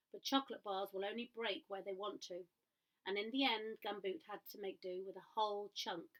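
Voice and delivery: plain reading voice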